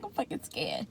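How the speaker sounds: very soft, under the breath; small scared admission. Retold or imitated. Patient hearing her heart monitor, hushed scared admission. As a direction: confess it quietly. Retold fear, said small then loud.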